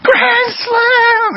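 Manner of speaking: put-on high, joyful shout of a kid; screaming kid. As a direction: yell it like a kid rounding the bases. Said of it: "he's screaming".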